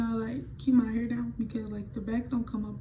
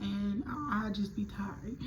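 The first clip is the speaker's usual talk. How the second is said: slow, breathy unvoiced trail-off; weary whispered sigh of a mom; tired whisper; 8 dB quieter, much slower than usual. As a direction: let it fade like exhausted mom. Mom explaining her long shifts.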